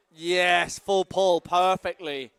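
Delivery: loud excited praise; excited cheer. Real, not acted. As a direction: cheer the landing. Cheering landed trick.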